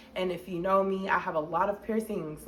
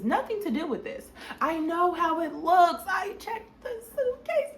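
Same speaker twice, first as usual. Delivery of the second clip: higher, tearful rush of self-defence; panicked pleading; 7 semitones higher than usual. Retold or imitated. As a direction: plead your innocence, voice cracking. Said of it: "I'm thinking".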